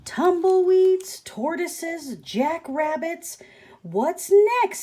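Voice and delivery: high, loud, slow exasperated rant. Acted. Grumpy cactus fed up with visitors, high loud exasperation. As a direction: throw up your hands at the parade of visitors.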